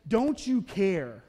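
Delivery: very slow, drawn-out accusing question; hurt complaint. Retold or imitated. Martha finally confronts Jesus, slow and aggrieved. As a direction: lean on each word, wounded.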